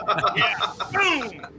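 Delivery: laughing, then a triumphant boom; excited laughing cheer. Real, not acted. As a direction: celebrate the lucky roll. Natural one rolled, excited.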